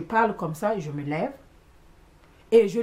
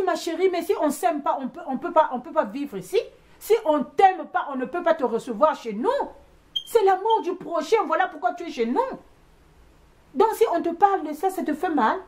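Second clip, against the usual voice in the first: animated remonstration; 8 semitones higher than usual. Retold or imitated. Retold remonstration to the younger woman.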